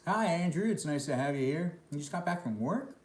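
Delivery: talking with a mouthful; words around a bite of food; mouth full. Real, not acted. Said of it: "someone telling me not to talk with my mouth full".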